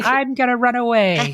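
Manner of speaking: high-pitched mock child threat; upset little girl. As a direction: play a small daughter threatening mom. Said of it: "her little daughter would get very upset".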